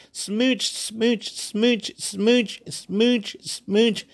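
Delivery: high, rhythmic repeated kissy word; imitated smooching brain. Brain loving the sport, imitated smooching chant.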